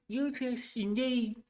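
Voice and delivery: put-on high, slow old man croak; old prospector. Shown as a demonstration. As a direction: curl the lips like an old-timer. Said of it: "curl your lips".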